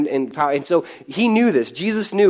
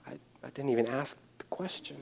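much softer, taken aback; sheepish mumble; 16 dB quieter than usual. Pretend. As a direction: mumble it, stunned he was interrupted. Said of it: "Nicodemus is like".

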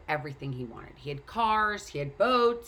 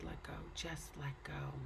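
hushed, whispery and much softer; soft, calm near-whisper from nowhere; disembodied voice; 11 dB quieter than usual. Acted. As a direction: breathe it out as an unseen voice. Retold voice from nowhere says it.

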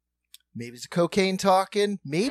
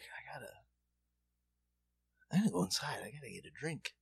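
much softer, trailing excuse; withered mumble; 12 dB quieter, faster than usual. Retold or imitated. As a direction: imitate someone wilting, very quietly. Wilting man excuses himself.

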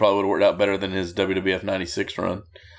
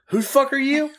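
much higher mock-incredulous boss; a scornful booker sneering at a nobody; Bill Watts impression. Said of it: "and Bill Watts is like".

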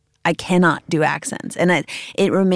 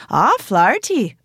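imitating an Irish immigration officer, higher pitched; mock Irish exclamation on reading a name; higher, lilting Irish impression; Irish accent; 7 semitones higher than usual. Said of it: "An Irish first. I'm in Ireland".

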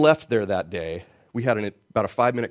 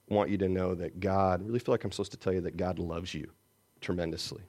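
gentle, sincere; 6 semitones lower, 8 dB quieter than usual. Retold or imitated. Gentle message to stranger.

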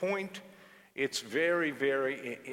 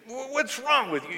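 angry driver; 12 semitones higher, 7 dB louder than usual. Retold or imitated. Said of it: "and he said".

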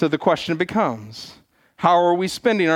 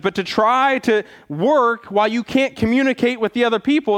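ordinary delivery

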